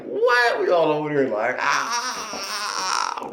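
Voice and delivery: high, loud cry of amazed joy; whooping at a surprise win; excited disbelief. Retold or imitated. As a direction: shriek like you can't believe you won. Team celebrating unexpected win.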